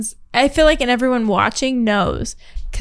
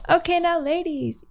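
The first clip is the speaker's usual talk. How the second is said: cheerleader voice; 6 semitones higher, 5 dB quieter than usual. Retold or imitated. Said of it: "Sounds like a cheerleader thing".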